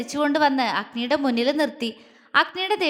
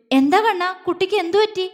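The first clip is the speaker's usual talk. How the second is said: higher, louder concerned questioning; worried father; 6 semitones higher, 4 dB louder than usual. A narrator's reading. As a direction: ask urgently what happened. Father worried about crying girl.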